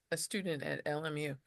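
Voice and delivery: normal talking voice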